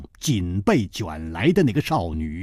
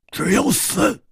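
icy threat rising sharply in pitch; chilling, raised-pitch warning; cold threat; 10 semitones higher than usual. A narrator's reading. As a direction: deliver the threat coldly, pitched up. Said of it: "一点红冷冷地打断了他们的话".

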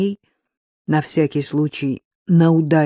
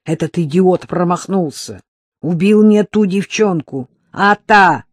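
louder, spiteful complaint; 3 semitones higher, 7 dB louder than usual. A narrator's reading. Man blames the killer who missed, spiteful and louder. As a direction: vent spite at the hired killer's blunder. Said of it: "злобно ответил он".

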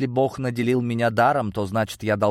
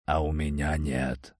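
put-on low, slow drunken scoff; 4 semitones lower, 4 dB quieter than usual. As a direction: scoff it dismissively. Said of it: "хмыкнул брюнет".

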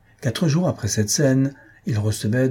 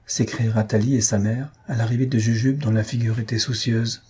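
ordinary delivery, nothing marked

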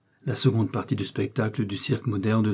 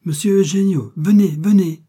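much higher and louder calling out; urgent call; 8 semitones higher, 8 dB louder than usual. A narrator's reading. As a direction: call down the hall for the manager. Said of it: "vivement appela".

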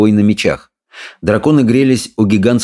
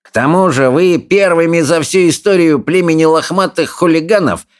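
sneering, sing-song bully, pitched way up; jeering bully; 11 semitones higher than usual. A narrator's reading. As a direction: taunt the others with relish.

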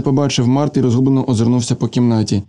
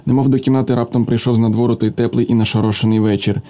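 ordinary delivery

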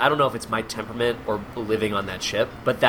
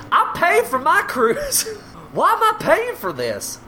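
high, put-on complaining voice; indignant passenger. Cruise passenger objecting to paid shows, high and indignant. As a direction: squawk a mock complaint high up.

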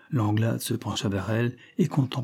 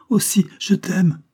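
high, loud, drawn-out plea; desperate protest; 9 semitones higher, 6 dB louder than usual. A narrator's reading. Mistress swearing love to her killer, desperately protesting. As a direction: insist desperately, slowing on the words.

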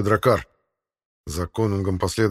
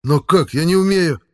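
high, loud, flustered cry; panicked protest; 7 semitones higher, 5 dB louder than usual. A narrator's reading. Young warrior asked to pull out arrow, panicked protest. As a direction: protest helplessly in alarm.

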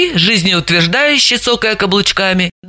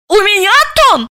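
high, indignant shriek of a question; возмущённо; indignant outrage; 14 semitones higher, 4 dB louder than usual. A narrator's reading. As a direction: leap up in outrage. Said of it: "подпрыгнула Заматаева".

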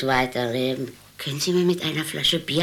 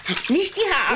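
imperious grande dame; 10 semitones higher than usual. Acted. Old woman grumbling in bath.

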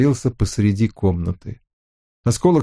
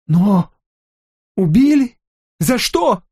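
высокий надломленный вопрос в ужасе; выкрикни вопрос в отчаянии; недоумение и ужас; 10 semitones higher, slower than usual. A narrator's reading. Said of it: "Недоумение и ужас исказили лицо". (растерянный ужас)

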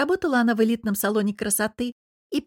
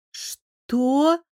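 a shocked, higher gasp of a question; stunned; 6 semitones higher than usual. A narrator's reading. Daughter stunned by father's confession, higher. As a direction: caught completely off guard. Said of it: "обомлела я".

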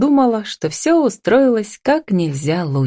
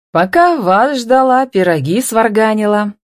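contented, lifted and unhurried announcement; pleased; 5 semitones higher than usual. A narrator's reading. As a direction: report it with satisfaction. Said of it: "довольно доложила мать".

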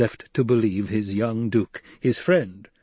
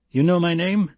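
startled, raised pitch; surprised question; 7 semitones higher, 3 dB louder than usual. Acted. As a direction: caught off guard, asking. Gurney startled by stranger.

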